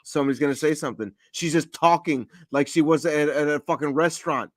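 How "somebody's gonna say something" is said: fast, nervous muttering; quick anxious self-talk; anxious inner thoughts. Retold or imitated. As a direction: rush it like worried thoughts. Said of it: "I was like".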